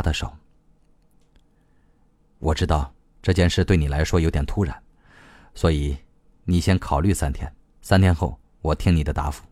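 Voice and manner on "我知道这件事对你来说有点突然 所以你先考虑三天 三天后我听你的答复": low, soft, grave delivery; serious. A narrator's reading. Proposer giving three days to decide, serious. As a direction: lay it out quietly and seriously. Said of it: "他表情严肃地说".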